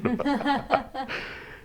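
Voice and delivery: a real, high burst of laughter. Real, not acted. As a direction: laugh high and freely at your own joke. Laughs at her own story.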